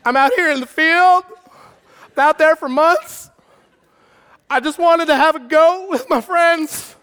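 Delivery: high, loud, hammed-up wail; pathetic tantrum. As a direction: whine and yell like the wronged older brother. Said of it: "this is the most pathetic verse".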